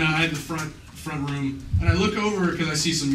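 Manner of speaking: ordinary delivery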